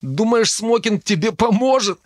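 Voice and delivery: high-pitched, jeering question. A narrator's reading. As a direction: tease a friend with a high, incredulous question. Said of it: "Дарт заржал у моего уха так громко". (насмешливо, высоким голосом)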